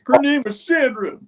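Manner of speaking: high, anguished imitation of a raving man; dazed attacker. Dazed widower repeating his wife's name, high plaintive cry. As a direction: cry it out high and loud, then ease off. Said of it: "in a, in a daze".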